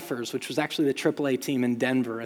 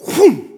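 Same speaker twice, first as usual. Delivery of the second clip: a louder whoom for the sudden pull; whoosh of a yank; 10 semitones higher, 12 dB louder than usual. Retold or imitated. Dad yanking son against fence, loud whoom. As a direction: sell the yank with a quick whoom.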